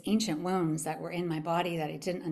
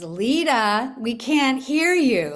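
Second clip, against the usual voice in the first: loud, higher, drawn-out call-out; super loud; 5 semitones higher, 8 dB louder, much slower than usual. Retold or imitated. Woman calls out the circle leader, super loud. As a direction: imitate someone calling across a whole room. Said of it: "like, super loud".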